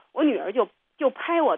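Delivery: usual speaking voice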